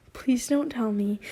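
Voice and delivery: ordinary delivery, nothing marked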